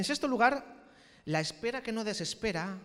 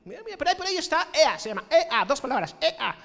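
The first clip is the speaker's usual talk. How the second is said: much higher, louder mock Andalusian chatter; imitating last week's guest; 11 semitones higher, 10 dB louder than usual. Preacher imitating last week's guest speaker, mock Andalusian voice. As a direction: parody the visiting speaker. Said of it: "Como diría, ¿no? El de la semana pasada".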